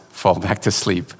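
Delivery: ordinary talk, nothing marked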